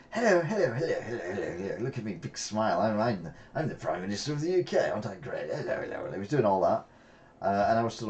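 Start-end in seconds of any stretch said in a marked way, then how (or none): 0.1-6.1 mock a grinning prime minister waving to the crowd; sing-song mocking greeting that fades into a mutter; Tony Blair impression. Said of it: "he does that stupid".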